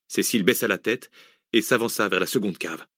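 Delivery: ordinary delivery, nothing marked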